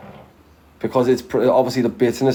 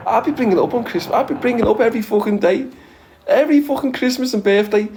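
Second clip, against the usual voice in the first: high, fast and giddy; gleeful excitement; 9 semitones higher, faster than usual. Acted. Planning to tease a friend's dad every Christmas, giddy and fast. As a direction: rattle it off with glee, pitch up.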